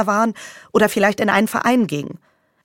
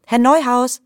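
louder, higher call across a room; 5 semitones higher, 4 dB louder than usual. Acted. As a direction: call his name out again. Calling again, louder.

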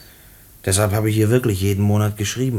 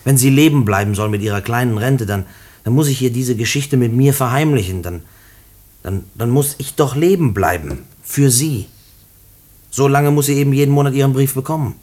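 louder, pleading, voice rising; desperate outburst; 4 semitones higher, 5 dB louder than usual. Acted. Prisoner pleading with the director, desperate and loud. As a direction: burst out fighting to stay alive for her.

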